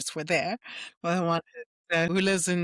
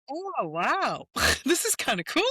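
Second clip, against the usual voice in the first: high, laughing, touched wow; giddy, pitched-up and moved; delighted; 8 semitones higher than usual. Retold or imitated. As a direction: let the laugh break into the words. Her reaction to daughters' praise.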